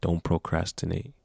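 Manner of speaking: ordinary delivery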